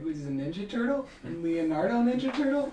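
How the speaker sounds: put-on high, loud little-kid voice with a lisp; little brother lisp. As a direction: play a small boy lisping about turtles. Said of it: "exactly what he sounded like".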